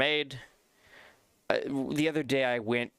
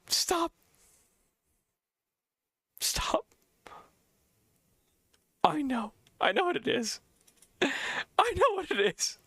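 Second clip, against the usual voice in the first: much higher, slow, drawn-out I know; excited recognition; 14 semitones higher than usual. Retold or imitated. Recognizing the song his friend shows him, overjoyed. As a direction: stretch it high like the answer just hit you.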